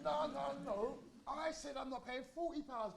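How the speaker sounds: put-on exasperated kid objecting; a touch of frantic protest. Protesting to judge.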